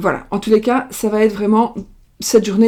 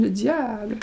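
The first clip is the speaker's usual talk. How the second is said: usual speaking voice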